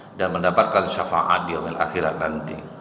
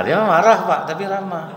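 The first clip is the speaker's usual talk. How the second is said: animated; 11 semitones higher, 5 dB louder than usual. Retold or imitated. Mimicking angry yet friendly tone.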